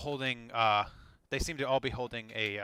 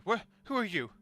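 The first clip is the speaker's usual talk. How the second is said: eloquent bandit; 6 semitones higher than usual. Acted. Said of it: "seems to be speaking quite eloquently".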